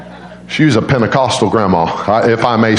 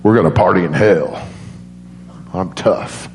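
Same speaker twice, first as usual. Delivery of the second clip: tough guy voice; 5 semitones lower than usual. Retold or imitated. Tough grandson voiced defiantly.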